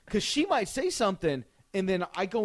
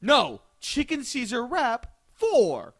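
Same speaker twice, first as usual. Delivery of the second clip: louder and slow, exasperated; slow insistent order; 7 semitones higher, 6 dB louder, much slower than usual. Retold or imitated. Correcting the clerk again, slow and firm. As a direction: hammer each word home. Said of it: "I'm like".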